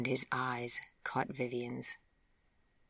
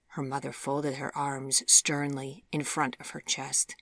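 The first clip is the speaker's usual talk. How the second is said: ordinary delivery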